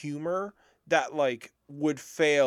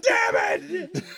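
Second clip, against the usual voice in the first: much higher curse through laughter; laughing mock curse; 4 dB louder than usual. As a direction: yell it while cracking up. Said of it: "He's like".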